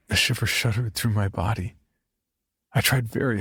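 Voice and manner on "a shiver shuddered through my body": usual speaking voice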